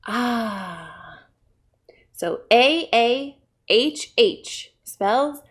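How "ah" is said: soft, long, falling aah; relaxed sigh. Acted. Frog in the water relaxing, relaxed sigh. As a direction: sigh out a contented ah. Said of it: "like relaxing".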